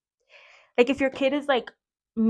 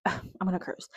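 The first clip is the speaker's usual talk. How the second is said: muttered aside; 9 dB quieter than usual. Retold or imitated. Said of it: "And I'm like".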